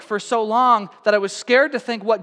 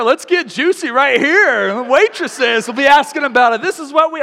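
mock eager friend; 6 semitones higher, 8 dB louder than usual. Friend urging him to spill everything, hammed up. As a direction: ham up the nosy friend egging him on. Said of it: "he just, like".

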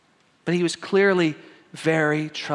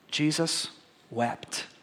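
much softer, falling away on wept; hushed verse; 9 dB quieter, much slower than usual. Acted. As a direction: let Jesus wept sink to almost nothing. The shortest verse, dropped low.

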